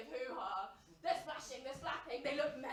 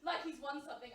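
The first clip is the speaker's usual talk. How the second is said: normal talking voice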